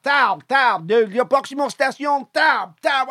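high, sing-song station call; French announcer. Retold or imitated. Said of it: "And you're like".

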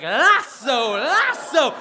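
loud, high cowgirl whoop; hammed-up rodeo call. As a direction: holler it like swinging a rope. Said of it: "like a cowgirl, she's like".

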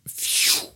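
breathy whoosh of a plane taking off; airplane takeoff noise. Retold or imitated. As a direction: blow out a jet takeoff sound. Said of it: "je fais très bien l'avion qui décolle". (bruit d'avion qui décolle soufflé)

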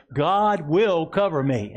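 slow, emphatic words for the church to echo; slow declaration to repeat. Shown as a demonstration. Pastor leading the congregation, slow and firm. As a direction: lead it slowly and firmly. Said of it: "Say it again".

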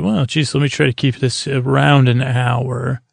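ordinary talk

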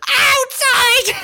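high, loud creature yelp; frantic shout. Acted. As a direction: yell it out in a panic. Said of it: "It goes".

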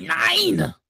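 high, drawn-out German refusal; mock German accent. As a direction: caricature of an indignant German. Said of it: "The German guy would be like".

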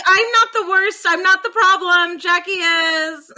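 hammed-up defensive whine; mock Misty protest. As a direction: plead your case loudly, slowing on the last words. Voicing Misty defending herself.

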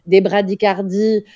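ordinary talk

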